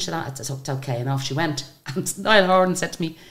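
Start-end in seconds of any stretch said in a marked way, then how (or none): none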